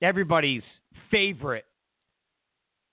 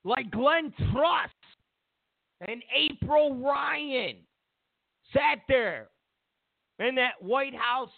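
heated rant; 6 semitones higher, slower than usual. Real, not acted. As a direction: rant at them, high and heated. Angry ranting at reporters.

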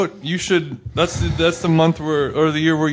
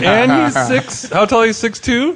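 curious Korean mom; 7 semitones higher than usual. Retold or imitated. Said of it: "and then you're like".

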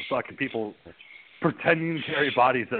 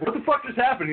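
high-pitched bewildered imitation; mock shock; 12 semitones higher than usual. Host as Lisa after vampire attack, mock bewilderment. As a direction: act stunned, pitch jumping way up.

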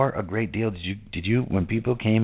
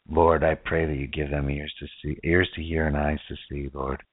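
prayer; 3 semitones lower than usual. Acted. Closing prayer.

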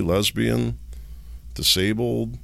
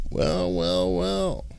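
drawn-out sarcastic well, well, well, last one leaping up high; mock well well well; 13 semitones higher, much slower than usual. Real, not acted. Host to viewers, relishing a gotcha: sarcastic drawn-out well well well. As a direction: savor each well like you caught him out.

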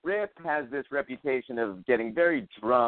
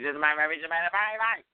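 ordinary talk

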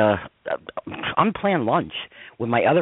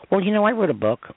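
mocking people; 8 semitones higher than usual. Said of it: "there hasn't been one person".

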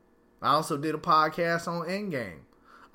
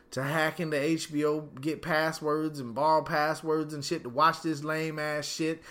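normal talking voice

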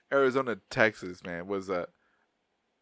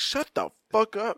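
higher, slow exasperated snap; mock self scolding; 11 semitones higher, slower than usual. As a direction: snap at yourself in a high voice. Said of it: "I'm like".

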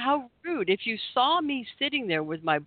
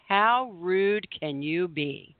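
each word pulled out slowly in mock indignation; slow indignant retort; much slower than usual. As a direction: spell it out, slowly, to shame her. Confronting the rude driver.

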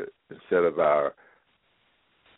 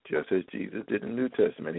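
ordinary talk

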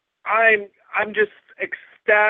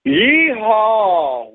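loud cowboy holler; yeehaw; 4 semitones higher, 7 dB louder than usual. Real, not acted. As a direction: whoop it out like a cowboy. Guest's cowboy entrance holler.

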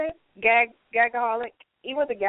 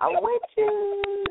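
high drawn-out; 9 semitones higher, slower than usual. Acted. Repeated line, first take higher.

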